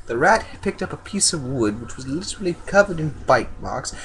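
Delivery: plain reading voice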